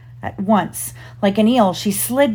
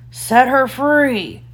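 slow, high commanding call; mock pirate captain, stretched words; Captain Hook; 5 semitones higher, much slower than usual. A narrator's reading. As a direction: give a drawn-out captain's order across water. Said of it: "made himself sound like Captain Hook".

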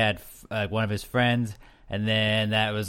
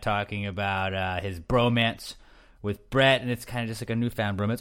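usual speaking voice

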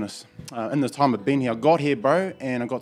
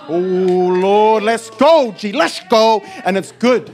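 louder, rising hype; excited praise; 8 semitones higher, 8 dB louder, much slower than usual. Real, not acted. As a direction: pump the room up.

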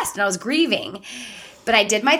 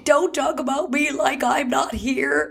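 slow, put-on movie line; Steel Magnolias quote; 4 semitones higher, slower than usual. Friend teasing Tracy with a movie scene, Steel Magnolias impression. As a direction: play the slow, dramatic film quote. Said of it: "Steel Magnolias".

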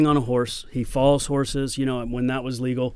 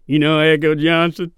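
louder imitation of the stuntman's accent; Ramon's accent; 3 semitones higher, 7 dB louder than usual. Stuntman on his knees asking the actor a question, imitated accent. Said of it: "he's got a really great accent".

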